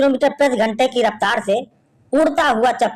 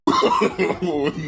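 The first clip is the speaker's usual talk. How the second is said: soft laugh; 6 semitones lower, 8 dB quieter than usual. Real, not acted. Laugh between jokes.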